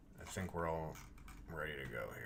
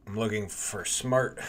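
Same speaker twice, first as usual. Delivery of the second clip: announcer voice; 3 semitones higher, 8 dB louder than usual. Shown as a demonstration. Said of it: "oh, the announcer voice".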